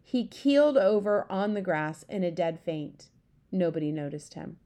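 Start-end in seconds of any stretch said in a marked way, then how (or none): none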